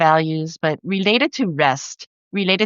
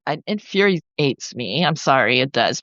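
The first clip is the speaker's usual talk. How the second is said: usual speaking voice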